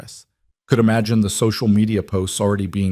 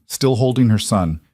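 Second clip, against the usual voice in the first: usual speaking voice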